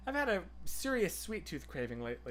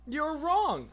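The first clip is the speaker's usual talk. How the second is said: higher, louder playful insistence; emphatic verdict; 10 semitones higher, 4 dB louder than usual. Real, not acted. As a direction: declare it like a final ruling.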